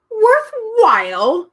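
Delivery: high, slow, loud outraged roar; indignant roar. A narrator's reading. The man roaring at Milo, indignant. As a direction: bellow the word in outrage. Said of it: "the man roared indignantly".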